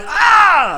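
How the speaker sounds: mock scream of pain, very high and loud; imitated pained yell. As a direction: scream out from the needle jab. Painful shot, he yells.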